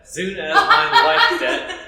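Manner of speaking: high, loud, breaking into laughter; laughing. Acted. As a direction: crack up while trying to say the line. Villain cracks up mid-threat.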